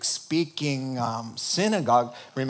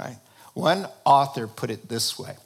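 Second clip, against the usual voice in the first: ordinary talk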